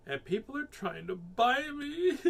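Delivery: high whine, stretched out; upset child. A narrator's reading. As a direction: whine it slowly, building toward a wail. Child complains to her father.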